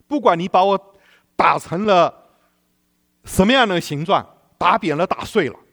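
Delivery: 高声宣告，越说越激昂; full-force preaching. Real, not acted. Climactic preaching. (讲道高潮的激昂宣告)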